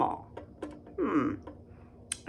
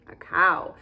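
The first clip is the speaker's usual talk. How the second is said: ordinary delivery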